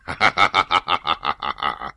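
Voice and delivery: a scornful villain's laugh at the prisoner; mocking laugh. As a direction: laugh him down with contempt. Villain mocks the captive chief.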